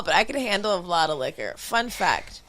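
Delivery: ordinary delivery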